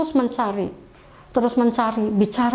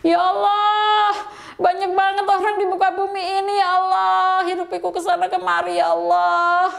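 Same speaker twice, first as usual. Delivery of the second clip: retold wailing prayer; 6 semitones higher than usual. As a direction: imitate wailing with sustained, almost chanted calls. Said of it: "Umi nangis teriak sejadi-jadinya di jalan". (menirukan ratapan, nada tinggi memanjang)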